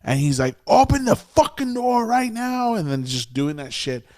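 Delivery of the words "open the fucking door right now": pissed-off yelling. Retold or imitated. Storyteller imitates furious guy at the gas station door, pissed-off high yell. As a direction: re-enact an enraged man demanding entry. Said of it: "super pissed off".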